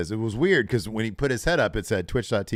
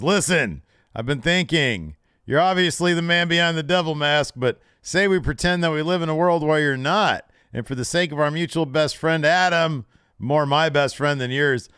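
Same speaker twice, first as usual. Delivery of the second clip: retold promo; 8 semitones higher, 6 dB louder than usual. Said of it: "And Strong says".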